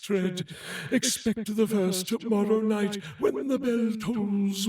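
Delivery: solemn, mournful ghost voice, slowed in places; a weary spirit warning the living; doleful spectre bound in chains; ghost of Marley. Acted. Marley's ghost speaks to Scrooge.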